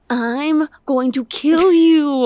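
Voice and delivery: slow, drawn-out mock threat with a whimper; mock furious, half crying. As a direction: wail it out like a fake meltdown. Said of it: "I'm just like".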